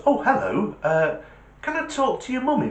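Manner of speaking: high, sing-song caller voice; talking to a child. Acted. Caller asking a child for a parent, high-pitched.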